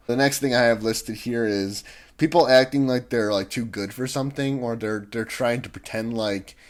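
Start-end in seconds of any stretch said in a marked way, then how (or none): none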